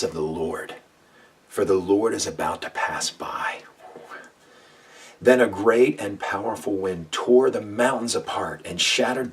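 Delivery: loud, booming preacher reading of the passage; dramatic scripture reading. Acted. Preacher reading First Kings to listeners, booming. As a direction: proclaim it like thunder over the mountain.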